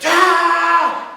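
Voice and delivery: mock yelp. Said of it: "And she goes".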